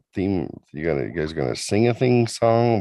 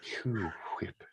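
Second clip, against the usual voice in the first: whispered Q-Whip; 15 dB quieter than usual. Pretend. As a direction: breathe out Q-Whip. Repeating the catchphrase.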